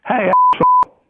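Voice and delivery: high, loud shouted insult; yelled insult. Retold or imitated. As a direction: bark it at him in a high yelling put-on voice. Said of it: "I go".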